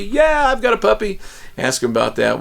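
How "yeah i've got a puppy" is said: high-pitched imitation of an eager kid; high, eager pupil voice; excited child. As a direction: pipe up high like a kid bragging. Voicing an excited child's answer.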